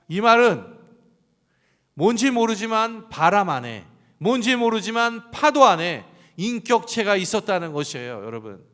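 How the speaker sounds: a high, loud preaching climax; full-force preaching. Real, not acted. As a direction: drive it home to the congregation.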